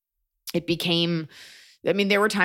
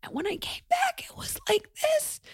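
imitating a blown-out, whispery squeak; weird lost voice; 5 dB quieter than usual. Comedian showing how she sounded after losing her voice, weird whispery voice. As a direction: squeeze it out high and thin, voice nearly gone. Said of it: "like, a weird voice for that".

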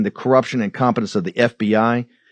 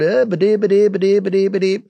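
mumbling Biden mockery; 6 semitones higher than usual. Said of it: "Look at Joe Biden wandering around".

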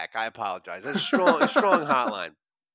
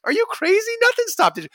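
much higher, mock-outraged "are you crazy"; incredulous retort; 13 semitones higher than usual. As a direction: fire it back as if the idea is absurd. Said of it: "I'm like".

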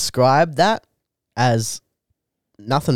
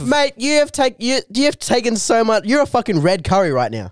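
high incredulous accusation; 6 semitones higher, faster than usual. Pretend. Acted accusation in skit.

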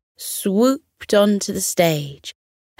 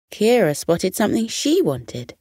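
ordinary delivery, nothing marked

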